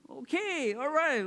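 high, exasperated giving in; mock reluctant agreement; grudging surrender. Acted grudging surrender to God.

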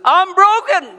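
high, loud, laughing moan; mock lament. Pastor mocking self-pity, laughing high wail. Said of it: "That's not the great news".

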